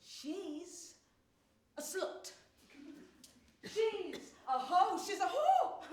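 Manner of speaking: sneering jeer that climbs into a laugh; mocking slur. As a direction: spit the insult like a sneering crowd. Voicing judgment of passersby.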